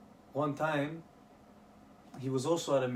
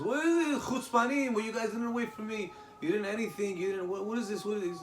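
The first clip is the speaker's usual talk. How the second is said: higher-pitched mock outrage; indignant outburst; 6 semitones higher than usual. Late guest confronting diners who didn't wait, indignant. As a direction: play an offended big shot demanding answers.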